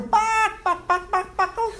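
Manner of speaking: high clucking chicken noises. Pretend. Teacher mocking cowards with high chicken clucks. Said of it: "How does the chicken sound?".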